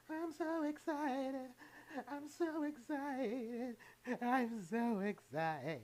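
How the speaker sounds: high, slow, overwrought "I'm so excited"; drawn-out hysterical TV teen voice. Retold or imitated. Cooking vlogger mimics a sitcom teen's breakdown, high and slow. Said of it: "she was like".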